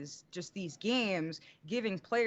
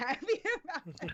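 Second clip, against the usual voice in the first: laughing; 5 semitones higher, faster than usual. Real, not acted. Laughing over stolen ball story.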